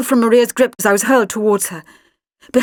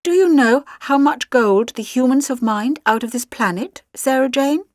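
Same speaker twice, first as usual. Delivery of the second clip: ordinary delivery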